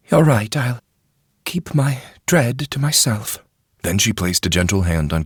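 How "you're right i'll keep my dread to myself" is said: much higher, louder fretful lady's voice; a court lady pouring out her worry; troubled noble lady. A narrator's reading. Wheeler yielding.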